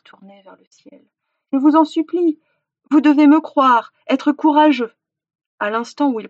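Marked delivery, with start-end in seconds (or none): 1.5-4.9 high, loud imploring; desperate plea. A narrator's reading. Hunter's last words as he turns to stone, pleading. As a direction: beg them with all remaining strength.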